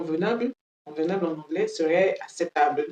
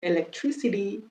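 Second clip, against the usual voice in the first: American English pronunciation, flapping the final t; American accent. Shown as a demonstration. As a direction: demonstrate "Electricity" with an American flapped t.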